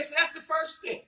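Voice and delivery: ordinary talk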